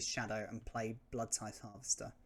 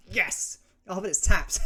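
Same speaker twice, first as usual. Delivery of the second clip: excited shout; 7 semitones higher, 11 dB louder than usual. Real, not acted. Drawing land, then disappointment.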